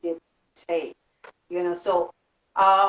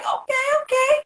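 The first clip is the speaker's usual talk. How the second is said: much higher, delighted "Okay, okay."; impressed okay. Retold or imitated. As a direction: squeal the two okays up high, impressed. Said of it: "I'm like".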